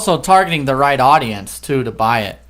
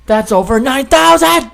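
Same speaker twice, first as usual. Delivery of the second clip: high, loud anime yell; Vegeta impression; 7 semitones higher, 3 dB louder than usual. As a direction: shout it like Vegeta from Dragon Ball Z. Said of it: "how good that impression was".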